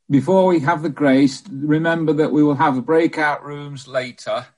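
ordinary talk

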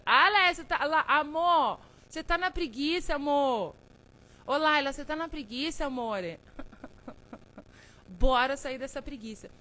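bem mais alto e agudo, chamando com carinho; chamado animado. Real, not acted. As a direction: chame as amigas da live, toda animada. Chamando seguidoras na live, animada. (chamando as seguidoras bem alto e animada)

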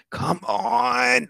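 high-pitched, exasperated plea; impatient urging. Retold or imitated. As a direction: urge it along, strained and high. Impatient, suspense is killing him.

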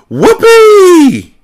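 high, loud squealed cheer; excited cry. A narrator's reading. Boys cheer at searching the ship, excited cry. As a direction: let out a long piercing whoop. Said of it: "cried the boys".

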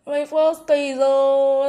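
high, loud playful wail; hammed-up moaning; mock lament. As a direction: wail it in pretend regret, holding the last word. Mock lament over first kiss.

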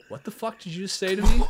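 acted-out offended comeback; indignant challenge. Retold confrontation in smoking story.

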